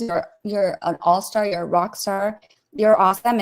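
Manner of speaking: normal talking voice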